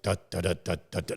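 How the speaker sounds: low sputtering engine imitation; sputtering engine noise. Imitating the engine coughing out mid-climb, low sputter. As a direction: mimic a dying engine, da da da. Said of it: "it's like".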